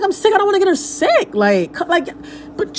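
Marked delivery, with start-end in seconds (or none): 0.0-1.3 high-pitched defensive protest; protesting excuse. Retold or imitated. Mother excusing a cancelled visit to her friend, protesting. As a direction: plead your case, voice raised high.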